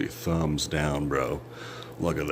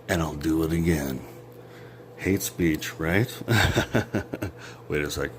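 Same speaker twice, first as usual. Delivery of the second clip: ordinary talk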